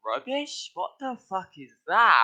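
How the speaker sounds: high, loud incredulous mock of a confused American; mocking American friend. Brit imitating his American friend's baffled reaction, high and loud. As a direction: squeak out the word like it's alien. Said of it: "And it's like".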